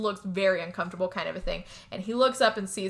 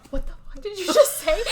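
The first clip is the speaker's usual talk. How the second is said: high, loud incredulous question through laughter; laughing mock disbelief; 9 semitones higher, 5 dB louder than usual. John reacting to George's remark, high laughing mock outrage. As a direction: pitch it way up, cracking up as you go. Retells John's shocked reply, laughing.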